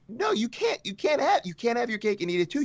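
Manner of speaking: high-pitched, squeaky exasperation; exasperated protest. Acted. Telling a game company it can't have both, exasperated. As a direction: jump up high in mock disbelief, tumbling over the words.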